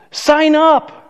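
rally the room to join; eager call. Real, not acted. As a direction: pastor urging the church to enroll, eager. Urging people to join class.

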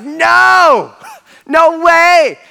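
high, drawn-out shout of protest; emphatic denial. Acted. Angel correcting followers who think it's over, emphatic shout. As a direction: shout it down in exaggerated disbelief.

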